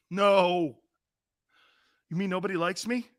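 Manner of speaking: usual speaking voice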